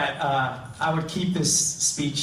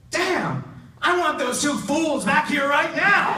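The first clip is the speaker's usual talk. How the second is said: much higher, louder exasperated shout; angry boss; 13 semitones higher, 3 dB louder than usual. Acted. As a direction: bark it like a fed-up account guy who wants his team back now. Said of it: "And Ed looks at the genie and says".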